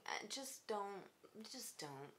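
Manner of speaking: voiceless, softening whisper; hushed plea to a drunk boyfriend; pleading whisper. Retold or imitated. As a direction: beg him quietly to stop.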